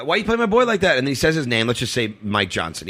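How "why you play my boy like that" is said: high-pitched imitation of the guy; accusing stranger. Stranger demands an answer for his friend, imitated high voice. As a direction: challenge him in a raised pitch. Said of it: "he goes".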